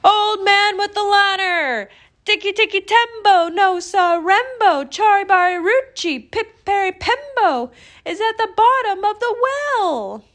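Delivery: put-on high, loud, straining child's yell; last-breath shout. A narrator's reading. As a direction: shout with all the breath left. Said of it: "he shouted".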